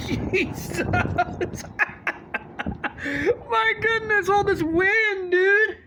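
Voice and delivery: high laughing cries in the wind. Real, not acted.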